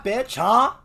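ordinary talk

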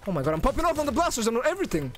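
high, fast rush of words; excited outburst. Real, not acted. Streamer marvels at popping off on the blasters, excited. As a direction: blurt it out in rising excitement.

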